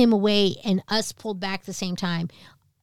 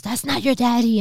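higher, insistent mom voice; emphatic retold correction; 6 semitones higher, 4 dB louder than usual. Crying mom corrects her child, retold insistence. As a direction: cut in with a firm denial.

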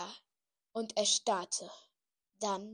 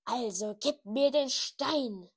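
menacing demand; 5 dB louder, slower than usual. A narrator's reading. Voldemort demands stone.

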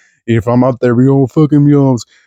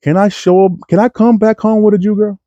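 much higher worried mock thought; anxious inner thought; 9 semitones higher than usual. Comedian voicing his nervous first thought, higher pitched. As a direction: fret it high like nervous about home. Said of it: "my first thought was".

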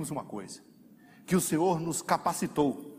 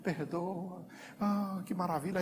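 much softer, easing into relief; relieved sigh; 3 semitones higher, 9 dB quieter, slower than usual. Retold or imitated. Believer whose heart finally eases, soft and relieved. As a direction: let the heart unknot, gently. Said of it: "o coração alivia".

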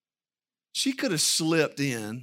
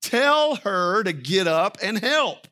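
Martha's complaint; 5 semitones higher, 3 dB louder than usual. Acted. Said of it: "and said".

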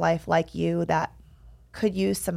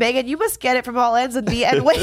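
high giggling through words; laughing; 5 semitones higher, 5 dB louder than usual. Real, not acted. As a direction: laugh while you talk. Laughing at the shared joke.

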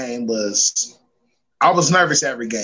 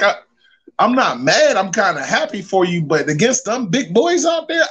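lightly voiced worried mom, higher; playing his mom, a touch higher; mother's voice; 6 semitones higher than usual. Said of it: "She like".

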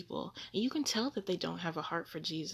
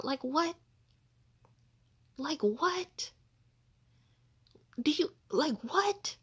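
high, louder "what?" rising; incredulous; 10 semitones higher, 5 dB louder than usual. Real, not acted. Baffled at the women online, high incredulous "what?". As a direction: squeak out your disbelief.

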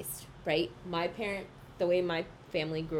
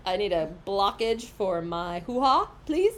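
loud mock plea; 6 dB louder, slower than usual. Mock loud request to the room.

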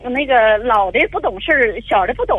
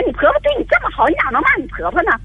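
higher, fast indignant reproach; reproachful scolding; 7 semitones higher, faster than usual. Retold or imitated. Mother-in-law retelling how she scolded daughter-in-law, indignant.

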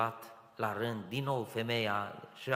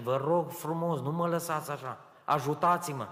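higher, louder teary begging; tearful plea; 6 semitones higher, 7 dB louder than usual. Retold or imitated. Crying young man begging the clerk for help, tearful plea. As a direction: ask for help with tears rising. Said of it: "Mi-au dat lacrimile".

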